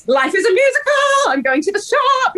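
high, sing-song giddy delight; giddy Anne Hathaway. Retold or imitated. Quoting Anne Hathaway's manic musical moment, high and giddy. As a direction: bubble over with joy like a musical star. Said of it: "when she's like".

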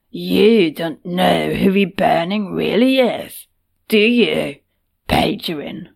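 slow, gruff and knowing; a gruff brute taunting; gruff sly voice. Acted. Patat speaking slyly.

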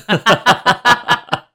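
high, loud spooky laugh; high giggle. Pretend. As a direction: cackle like a ghost. Spooky laugh after feeling cold.

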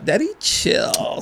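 soft, high, very slow mimicry; drawn-out mock calming voice; imitating the dude. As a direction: stretch it out, high and hushed. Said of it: "But the way he said".

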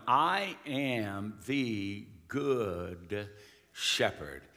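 drawn out and dropping very low on shepherd; solemn slow scripture voice; slow solemn reading. Shown as a demonstration. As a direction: read it slowly like scripture, sinking at the end. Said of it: "to twenty-one".

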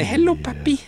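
very high, silly little voice; put-on kid voice. Joking son greeting his pretend father, put-on high voice. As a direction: squeak it out as a joke child. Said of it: "if I do the voice".

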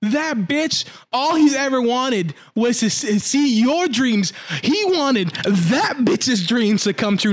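high, slow, drawn-out indignation; outraged emphasis. Real, not acted. Podcaster defends the betrayed boy, high drawn-out indignation. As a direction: stretch the key words in disbelief. Teller outraged on the boy's behalf.

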